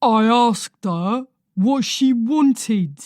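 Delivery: slow, loud and hostile; husband glaring at the visitor, pressing; menacing old man. A narrator's reading. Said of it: "brown eyes glaring".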